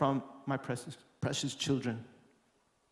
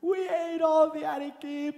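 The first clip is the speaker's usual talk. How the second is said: put-on guilty kids; 9 semitones higher than usual. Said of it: "the kids are like".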